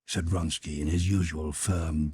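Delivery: ordinary delivery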